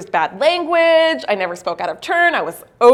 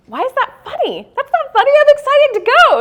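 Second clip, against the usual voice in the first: much higher, rising to louder protest; protest, baffled and offended; indignant confusion; 5 dB louder than usual. Retold or imitated. Asking Miguel why her Spanish is funny, high and indignant. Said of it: "I'm like".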